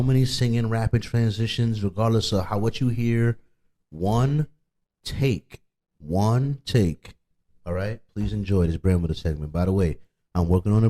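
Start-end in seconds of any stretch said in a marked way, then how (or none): 3.9-7.1 slow, spaced one take; emphatic repeat. Real, not acted. Rapper stressing to haters it was one take, slow and spaced. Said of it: "emphatic repeat".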